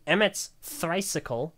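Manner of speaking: ordinary talk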